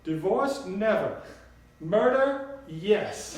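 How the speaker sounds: high, loud, emphatic Southern grandmother; Southern drawl. A narrator's reading. Grandmother joking about murdering Papa, loud Southern drawl. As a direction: proclaim it boldly in a Southern voice. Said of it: "in her sweet southern drawl".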